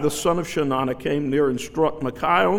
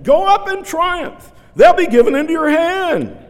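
sarcastic; 13 semitones higher, 8 dB louder than usual. Retold or imitated. Micaiah mocking the king with the false prophets' words. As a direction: sneer it back like a false prophet. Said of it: "I see Micaiah say it like that".

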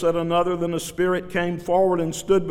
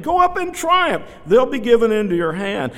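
sarcastic; 7 semitones higher, 4 dB louder than usual. Retold or imitated. Micaiah mocking the king with the false prophets' words. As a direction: sneer it back like a false prophet. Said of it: "That's what all these other people are saying".

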